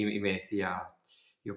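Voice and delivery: usual speaking voice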